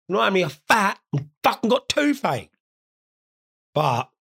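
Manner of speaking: ordinary talk, nothing marked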